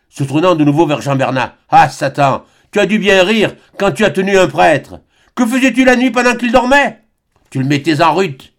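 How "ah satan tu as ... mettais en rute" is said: loud, rising, high furious tirade; raging accusation. A narrator's reading. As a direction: spit it at the devil. Le frère insulte Jean Bernat. (le frère furieux accuse Bernat)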